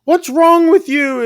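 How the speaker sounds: high, loud imitated demand; outraged yell. Parents' outrage in quarrel.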